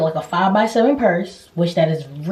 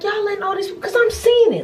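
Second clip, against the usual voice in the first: much higher, exasperated; worked up. Retold or imitated. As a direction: replay the annoyance. Retelling irritation at door.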